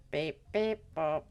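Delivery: flat beep-boop robot noises. Pretend. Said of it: "Now I can do the robot voice".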